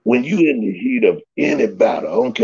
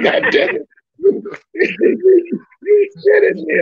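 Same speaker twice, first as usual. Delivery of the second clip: laughing exclaim. Retold or imitated. Ken laughing after realizing the trick.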